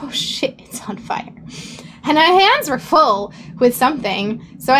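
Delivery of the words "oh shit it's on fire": voiceless hushed whisper of sudden alarm; retold whisper. Telling the stream she froze at the fire, whispering. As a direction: breathe it out, stunned by the flames. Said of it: "I'm like".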